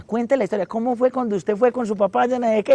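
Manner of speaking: put-on grandma urging the boy to tell it; high, prodding grandma prompt; imitating grandma. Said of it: "me decía".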